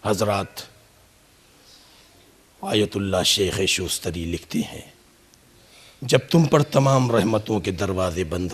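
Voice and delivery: low, hushed supplication; closing prayer. Acted. (धीमी, दबी आवाज़ में दुआ)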